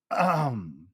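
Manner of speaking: a formal little throat clear before the speech. Acted. Boy clears his throat before his class speech, formally. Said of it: "began my oration".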